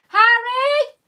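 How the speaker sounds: loud, high call across the house; long, carrying call for her son. Real, not acted. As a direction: shout up the stairs for Harry. Calling her son from upstairs.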